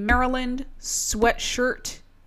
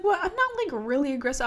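high defensive roommate; 6 semitones higher, faster than usual. Retold or imitated. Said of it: "she was like".

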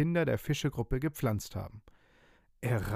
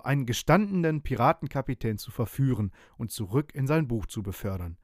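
ordinary delivery, nothing marked

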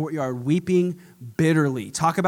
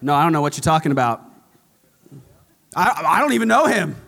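rushed, louder panicked denial; flustered denial; 5 dB louder, faster than usual. Retold or imitated. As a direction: blurt it out in a panic. Peter panics, fast denial.

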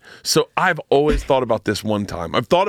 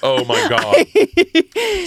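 high, slow and louder oh my God; drawn-out disbelief; 4 dB louder, much slower than usual. Real, not acted. Reacting to a friend's bathtub joke, stunned. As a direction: stretch it out in shock.

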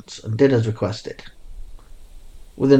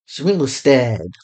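plain reading voice

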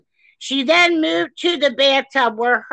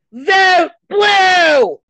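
loud, cracking cry of a demand; impassioned shout; 4 semitones higher, 5 dB louder than usual. Real, not acted. Host begging her audience to vote, crying shout. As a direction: plead with them at full voice. Ranting urging listeners to vote.

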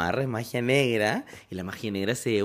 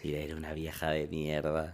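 much lower, softer, slow mock-insult; 10 semitones lower, 11 dB quieter than usual. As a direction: growl the insult in jest. Teasing insult to friend. (insulto de broma, grave y bajo)

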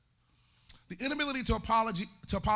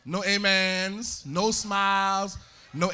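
dead, zombie-like listing; 5 dB louder, much slower than usual. Shown as a demonstration. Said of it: "like the Church of the Zombies".

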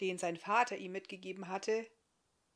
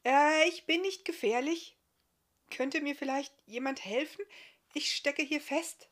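high, raised, amiable appeal; friendly calling out; 7 semitones higher, 8 dB louder than usual. A narrator's reading. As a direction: call out politely for help. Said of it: "rief Barthamiel freundlich".

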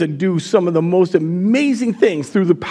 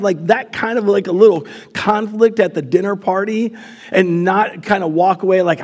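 ordinary talk, nothing marked

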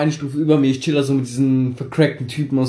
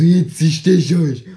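put-on slow, louder, menacing kid voice; threatening kid; 3 semitones higher, 5 dB louder, much slower than usual. Ten-year-old pulls a knife on the boys, slow menacing threat. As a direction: drag out the threat like a wannabe tough guy. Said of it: "der so".

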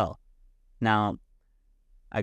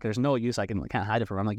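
plain reading voice

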